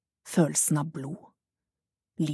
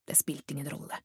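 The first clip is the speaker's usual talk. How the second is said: barely voiced, much softer whisper; whispered thought; 10 dB quieter than usual. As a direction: breathe it as a secret to herself. Jet's private thought.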